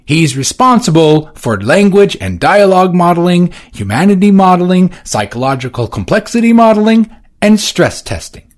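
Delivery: high, loud listing; formal Johnson. Acted. Johnson listing Feste's duties to Edward. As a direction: tick off each item.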